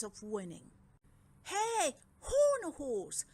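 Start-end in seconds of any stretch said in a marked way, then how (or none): 1.4-3.2 loud high call across the track. Acted. Twins calling to their friend the Horned Horse, loud and high. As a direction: hail your friend from afar. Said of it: "called Dragonix and Dragonax".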